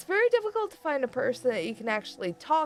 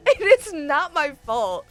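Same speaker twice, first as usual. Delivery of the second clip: mock angry rant; 6 semitones higher, 5 dB louder than usual. Snapping back at the friend over Reddit, loud mock fury. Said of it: "I'm angry".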